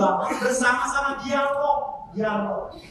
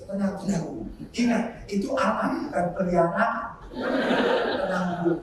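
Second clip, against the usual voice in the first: lebih pelan dan rendah; menenangkan; 6 semitones lower, 4 dB quieter than usual. Retold or imitated. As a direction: redakan amarah ibu dengan suara rendah. Pastor menenangkan ibu yang marah. (menenangkan dengan suara rendah)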